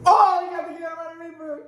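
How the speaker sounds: high, laughing shout of delight; excited laughing shout. Real, not acted. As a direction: shriek it out with laughter. Friend draws the hottest bean.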